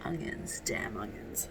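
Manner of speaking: hushed, breathy grumble under the breath; whispered curse. Real, not acted. Stung by onions while chopping, whispering a curse.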